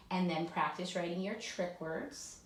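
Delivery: usual speaking voice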